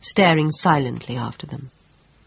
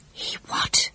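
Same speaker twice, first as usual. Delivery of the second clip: breathless, disbelieving whisper; barely able to speak; stunned whisper; 5 dB quieter than usual. Acted. Stunned reaction to news.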